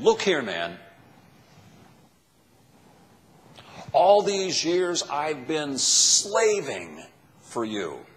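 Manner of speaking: loud, slow, resentful outburst; disrespectful complaint. Acted. Older son confronting his father, loud and bitter. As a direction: throw the years of work back at him. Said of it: "This is so disrespectful what he says".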